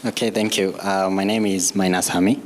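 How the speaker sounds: ordinary talk, nothing marked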